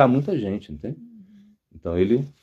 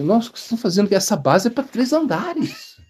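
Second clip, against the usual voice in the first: astonished engineer; 7 semitones higher than usual. Retold or imitated. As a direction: stretch it out in amazement, pitched up. Engenheiro espantado com a base.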